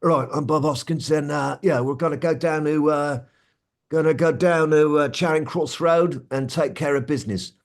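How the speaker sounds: Bob Hoskins. Acted. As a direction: talk like a London Cockney with a little rasp. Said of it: "Bob Hoskins sort of talks like this".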